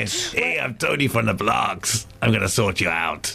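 half-hearted, slow Bronx accent; American accent attempt. Shown as a demonstration. Embarrassed co-host copying the host's Bronx accent, tentative. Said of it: "give us your best American accent".

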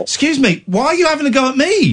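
high-pitched aggrieved protest; mock indignation. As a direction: protest as if unfairly picked on. Mock-wounded protest in vegan row.

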